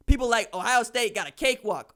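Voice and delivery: ordinary delivery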